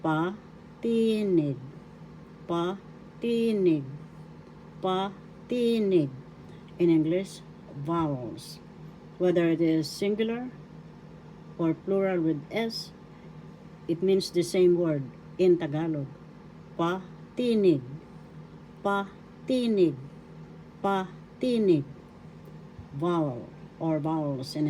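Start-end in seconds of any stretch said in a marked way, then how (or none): 0.0-6.1 "Patinig" very slowly, pa, then a long stressed TI; slow teacher demo of "Patinig"; second-syllable accent. Shown as a demonstration. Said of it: "The accent is in the second syllable". 16.8-21.8 "Patinig" slowly, pause after pa, long TI; slow, measured teacher demo of "Patinig"; second-syllable accent. Shown as a demonstration. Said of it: "The accent is in the second syllable".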